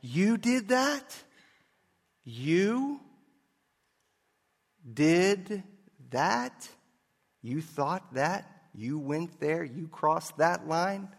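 slow, mocking accuser, drawn out in disbelief; accusing devil voice. The devil accusing a sinner, slow scornful taunt. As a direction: taunt each word slowly, dripping with scorn. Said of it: "the accusing voice of the devil saying something like".

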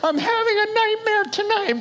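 put-on high squeaky child whining about a nightmare; scared child voice. Preacher voicing his kids after scary stories, squeaky and high. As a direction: play the kid who can't sleep, squeaky and high.

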